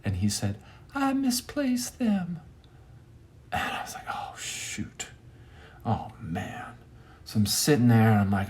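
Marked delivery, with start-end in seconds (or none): 1.0-2.4 very high, slow, soft reply; really high voice. Retold or imitated. Shirtless man explaining missing clothes, really high voice. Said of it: "In a really high voice". 4.1-6.8 whispered, sinking reaction; hushed dismay. Retold or imitated. Storyteller hearing the man's answer, hushed dismay.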